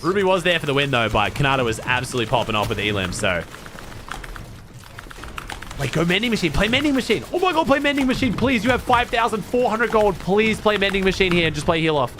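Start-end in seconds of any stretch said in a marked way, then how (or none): 5.8-10.6 building from asking to begging higher; urgent plea that keeps rising; pleading. Real, not acted. Begging player to use item.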